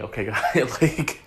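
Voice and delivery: loud laugh breaking into the words; real laughing. Real, not acted. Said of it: "I shouldn't be laughing".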